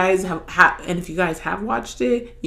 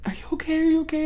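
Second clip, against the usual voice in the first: put-on girlfriend's voice; 11 semitones higher, much faster than usual. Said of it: "my girlfriend will be like".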